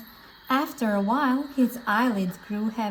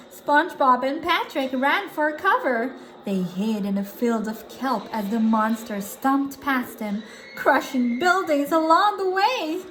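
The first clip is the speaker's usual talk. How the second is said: ordinary delivery, nothing marked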